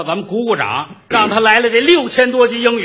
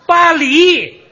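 巴黎 called out higher and louder; loud correction; 9 semitones higher, 6 dB louder than usual. Shown as a demonstration. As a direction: shout the right name 巴黎. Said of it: "correcting partner's Paris". (提高嗓门纠正巴黎)

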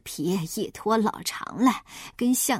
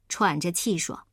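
ordinary delivery

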